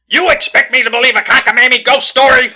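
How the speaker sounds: loud, high-pitched incredulous outburst; outraged disbelief. Acted. Detective rejecting a ghost story, outraged. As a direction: explode at an absurd claim.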